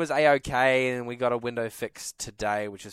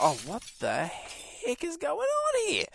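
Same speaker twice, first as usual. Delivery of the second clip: high, slow, baffled; as if stunned by a strange noise at night; startled disbelief; 13 semitones higher, 5 dB quieter, slower than usual. Retold or imitated. Retelling the night he heard glass break, high startled slow. Hearing a second crash at night.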